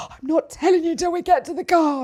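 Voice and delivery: mock distressed refusal from a young boy; imitating upset child. Son refusing to tell mum at school, upset and insistent.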